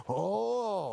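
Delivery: high, drawn-out "Oh"; K-drama double take. Retold or imitated. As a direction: act fascinated with a high "Oh". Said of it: "act interesting as hell going".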